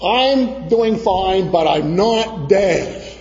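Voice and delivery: slow, emphatic retold answer; rehearsed firm reply. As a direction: spell it out slowly and firmly. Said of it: "I knew exactly what to say".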